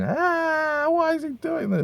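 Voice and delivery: hammed-up child screaming in terror; mock screaming kid. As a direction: shriek like a panicked child. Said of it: "the kid was just, like, screaming".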